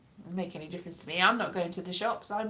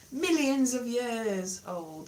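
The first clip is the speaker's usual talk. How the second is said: slow dramatic; 3 semitones higher, much slower than usual. Pretend. Jokey exaggeration of age.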